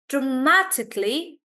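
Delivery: dramatically with MA punched; stress on MA. Shown as a demonstration. Teacher demonstrating dramatically, stress on ma. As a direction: hit "ma" in dramatically. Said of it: "Stress is on ma".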